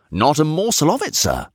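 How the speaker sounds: high, emphatic denial; emphatic servant. A narrator's reading. Son defending his father's memory to master, eagerly. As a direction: pipe up in firm denial, dropping on the end.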